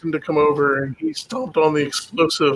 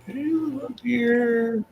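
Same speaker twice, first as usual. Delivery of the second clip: slow, high coaxing call; 8 semitones higher, 4 dB quieter, slower than usual. Acted. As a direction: sweet-talk a wary animal. Luring a deer in game.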